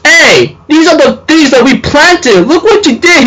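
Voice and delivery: high, rising complaint from a student; upset student. Retold or imitated. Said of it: "the student says".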